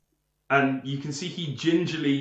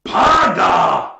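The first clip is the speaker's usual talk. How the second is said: loud, high ogre shout; ogre roar; 6 semitones higher, 13 dB louder than usual. Acted. Ogre pointing at himself to introduce himself, roaring. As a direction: bellow your own name as an ogre. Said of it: "he points at himself and he says".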